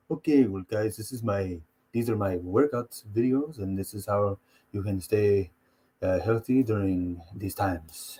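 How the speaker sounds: low, slow, soft-spoken fighter; Ngannou. Retold or imitated. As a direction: imitate a gentle, slow-talking workout host. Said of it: "Ngannou's just like ... He's like".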